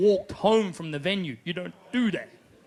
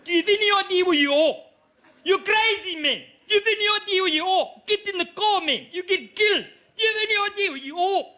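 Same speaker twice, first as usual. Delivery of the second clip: high, loud, rapid South African; South African local; 10 semitones higher, 5 dB louder than usual. Retold or imitated. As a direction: yell a frantic warning in a thick South African accent. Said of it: "This guy goes".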